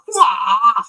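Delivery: high, loud kung fu yelp; mock nunchuck battle shriek; martial-arts cry. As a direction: yelp like a hammed-up karate attack. Acting out nunchuck attack.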